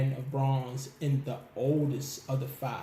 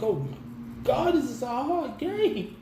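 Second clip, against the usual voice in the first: exasperated gamer; 10 semitones higher than usual. Retold or imitated. Sent back after beating a fighter, mock exasperation. Frustrated at the video game.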